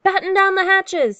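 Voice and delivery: high, loud command to the crew; storybook captain's urgent order; captain's shouted order. A narrator's reading. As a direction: call it out over a storm. Captain orders crew before storm.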